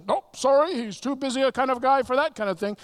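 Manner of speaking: high, loud, brushing-off voice; mock the disciples shooing people away; dismissive disciples. Disciples voiced dismissively, sermon retelling.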